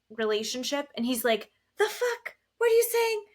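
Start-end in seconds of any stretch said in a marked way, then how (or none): 1.8-3.2 very high-pitched incredulous imitation; mock outburst. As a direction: squawk it like someone flying off the handle. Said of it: "Florian flies off the handle".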